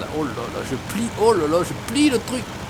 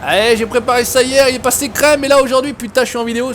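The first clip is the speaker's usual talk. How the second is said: raised, higher-pitched protest; exasperated complaint; 6 semitones higher, 4 dB louder than usual. Real, not acted. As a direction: plead in frustration at the stuck truck. Truck fails during the recording.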